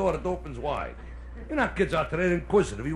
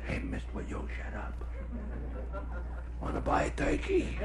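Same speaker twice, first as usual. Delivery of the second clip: whispered pestering from a hiding place; very soft, furtive whisper; secretive whisper; 11 dB quieter than usual. Acted. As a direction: whisper so nobody outside hears. Kid whispers while hiding in closet.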